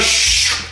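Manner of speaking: long voiceless "shh" whoosh; rushing-air sound effect; whooshing noise. Acted. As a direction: imitate a body flying through the air. Sound of second window jump.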